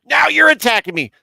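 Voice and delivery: loud, high-pitched playful indignation; mock outrage. Joking to Matt that he will be unmodded, mock outrage. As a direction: pretend to be offended and cry out. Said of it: "Just kidding".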